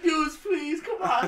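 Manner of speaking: high, pleading whine; mock a friend begging to stop; whiny begging friend. Telling friends how John begged them to stop, high whine. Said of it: "He's just like".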